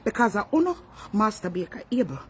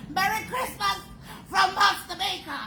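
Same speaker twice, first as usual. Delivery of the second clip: sing-song farewell; 11 semitones higher than usual. Real, not acted. Closing sign-off.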